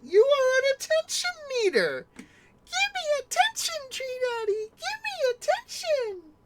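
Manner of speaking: high squeaky whiny cat voice; mock a clingy pet begging; high whining pet voice; needy cat voice.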